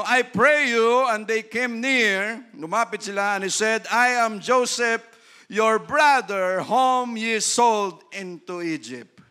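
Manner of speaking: loud, slow solemn Bible reading; scripture reading. Acted. Pastor reading Genesis aloud to the church, in a solemn, ringing voice. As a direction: proclaim the verse to the congregation.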